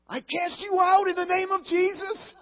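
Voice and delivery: put-on high, loud laughing shriek of a sweet little old lady; hammed-up shout, casting out the devil while cracking up; mock exorcism shriek. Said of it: "she looked at him and she goes".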